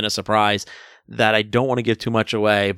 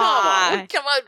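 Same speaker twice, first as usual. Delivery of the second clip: mock protest; 9 semitones higher than usual. Real, not acted. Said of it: "I'm not gonna let that joke go by".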